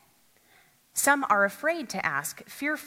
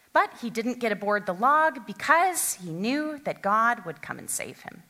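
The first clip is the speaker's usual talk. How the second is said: ordinary talk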